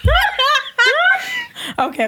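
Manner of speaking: squealing laugh, drawn out slow; shrieking laughter; high laugh. Real, not acted. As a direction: burst out laughing between words. Collapsing in laughter.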